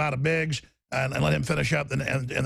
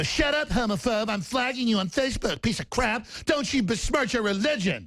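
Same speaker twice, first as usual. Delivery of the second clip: mocking liberals; 5 semitones higher than usual. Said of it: "they go".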